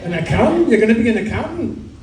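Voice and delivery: scandalised rising disbelief; disgusted parents. Retold or imitated. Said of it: "Their parents were disgusted".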